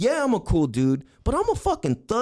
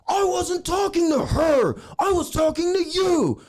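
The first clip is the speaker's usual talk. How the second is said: high, indignant shouting; mock angry yelling; 10 semitones higher than usual. Angry man rounding on the one who stepped in, imitated yell. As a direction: yell back like an affronted hothead. Acted angry rival in confrontation.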